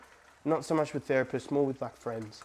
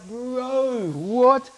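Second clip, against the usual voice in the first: disbelief; 7 semitones higher, 5 dB louder than usual. Retold or imitated. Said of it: "I was like".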